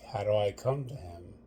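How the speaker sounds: ordinary talk, nothing marked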